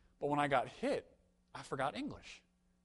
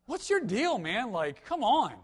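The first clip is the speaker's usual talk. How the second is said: much higher, louder exasperated cry; frustrated outburst; 7 semitones higher, 7 dB louder, slower than usual. Retold or imitated. Telling his roommate off over a ruined haircut, high loud exasperation. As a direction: fling it at him like a wronged customer. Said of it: "And I'm like".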